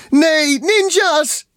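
very high, loud yell of alarm; alarmed cry. A narrator's reading. Takel seeing the ninjas attack, high alarmed shout. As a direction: cry out at a shrieking height. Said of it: "riep Takel".

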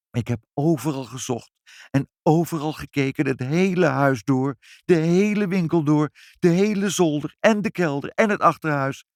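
high, insistent listing; exasperated lament. Acted. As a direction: pile up each place searched, pitch rising. Despairing account of a fruitless search. (klagende, wanhopige opsomming)

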